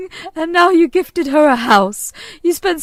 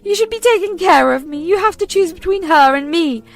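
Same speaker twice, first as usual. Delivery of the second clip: high, loud guilt-tripping mother; shrill ultimatum; 7 semitones higher, 6 dB louder than usual. Acted. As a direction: demand he choose her.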